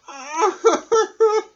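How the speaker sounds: loud, high hammed-up wail; crocodile wailing. Crying crocodile with a swollen jaw, howling wail. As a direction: howl out the crocodile's pain. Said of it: "he was crying real tears".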